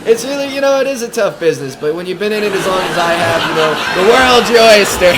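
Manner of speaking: loud, high comedian working the crowd; brash club comic playing to the room; stand-up stage act. Comic working a club crowd, brash and loud.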